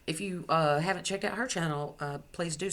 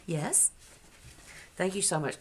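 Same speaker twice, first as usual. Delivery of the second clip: ordinary delivery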